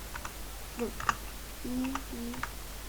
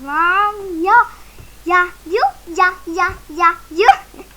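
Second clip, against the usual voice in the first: loud, excited yuh yuh; excited yelling; 3 semitones higher, 9 dB louder, much slower than usual. Real, not acted. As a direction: yell at the game in excitement. Oyunda heyecanla bağırıyor. (heyecanlı bağırış)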